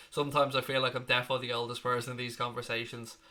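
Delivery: usual speaking voice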